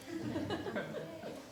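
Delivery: brief chuckle at own joke; short laugh. Real, not acted. Preacher laughing at own third-point joke, brief chuckle. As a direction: laugh lightly.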